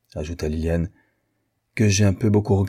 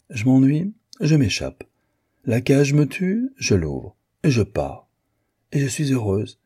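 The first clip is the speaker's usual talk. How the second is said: lively escape account; 7 semitones higher than usual. A narrator's reading. Acted breezy sketch of divorcing.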